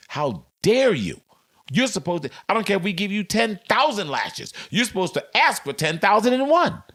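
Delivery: high, indignant mock scolding; hammed-up outrage climbing into a high-pitched rant.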